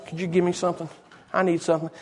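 soft, low, offhand request; casual understated ask. Acted. Preacher mimicking how the beggar didn't ask, quiet and casual. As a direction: ask quietly, as if it hardly matters. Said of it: "He wasn't there just saying, you know".

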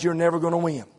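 normal talking voice

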